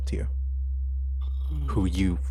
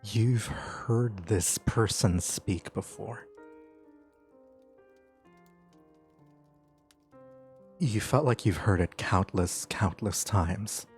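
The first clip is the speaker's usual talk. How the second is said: slow, hushed scene narration; game master's scene setting; 4 dB quieter, slower than usual. Acted. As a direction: draw them into a dreamlike scene. GM sets the dream scene.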